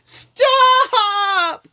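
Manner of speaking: very high, loud shriek; frantic scream. A narrator's reading. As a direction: scream in full panic. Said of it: "screamed Lord Gur".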